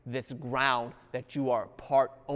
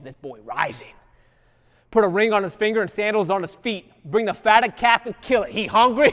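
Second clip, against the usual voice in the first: loud, rising sermon delivery; loud gospel storytelling; full-force preaching; 3 semitones higher, 9 dB louder than usual. Acted. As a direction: preach it out to the pews. Preaching the prodigal son.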